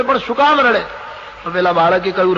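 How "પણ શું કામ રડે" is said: higher, pressing question from the worried parent; more insistent asking. Retold or imitated. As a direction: ask again, more urgently. Parents ask crying child twice.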